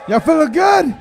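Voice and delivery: high, loud shout to the room; hyping the crowd. Real, not acted. Comic opening his set, loud hype shout. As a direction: pump the audience up.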